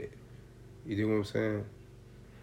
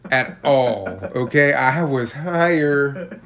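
emphatic; 3 semitones higher, 7 dB louder than usual. Real, not acted. Stressing how strong edibles are.

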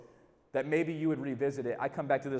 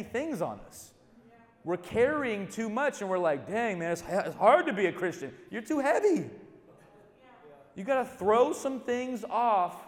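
loud, rising, slowed preaching; preaching at full force; 4 semitones higher, 5 dB louder than usual. Acted. Preacher urging the crowd to throw off weights, loud and high. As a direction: drive it home to the congregation.

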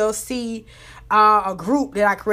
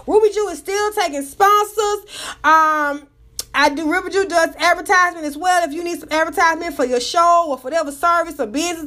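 upbeat promo pitch; ad read; 4 semitones higher than usual. Acted. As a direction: sell it like an ad. Ad pitch for her services.

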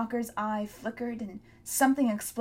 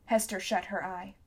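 ordinary delivery

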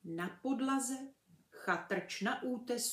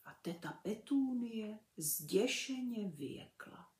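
usual speaking voice

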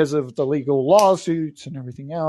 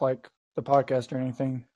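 ordinary talk

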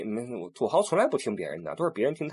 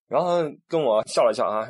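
usual speaking voice

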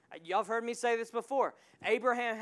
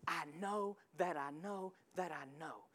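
soft, low, certain; quiet lower inner certainty; hushed conviction; 5 semitones lower, 11 dB quieter than usual. Real, not acted. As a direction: say it like a secret you're sure of. Intimate faith declaration.

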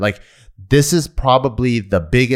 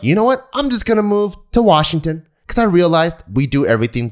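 high breezy realization voice; tossed-off "you know what"; casual quoted friend; 7 semitones higher than usual. Retold or imitated.

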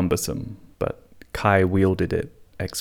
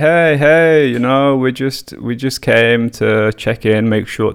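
police check-in voice; 4 semitones higher, 6 dB louder, faster than usual. Retold or imitated. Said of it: "they do that police thing".

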